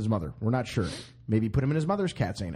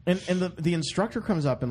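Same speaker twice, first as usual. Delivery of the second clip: ordinary talk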